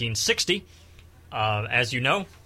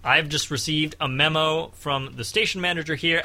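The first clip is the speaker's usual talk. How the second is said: ordinary talk, nothing marked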